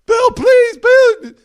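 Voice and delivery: very high, loud desperate shriek; screaming plea. Retold or imitated. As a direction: scream for mercy.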